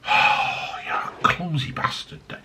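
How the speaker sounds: soft whispered grumble at himself; hissing under his breath after the spill; self-scolding whisper. Real, not acted. Reviewer spills cider on desk, whispered self-scolding.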